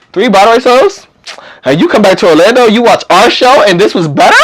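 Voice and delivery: high, incredulous questioning; acted disbelief. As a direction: question it as if you can't believe the praise. Retelling amazed reply to a compliment.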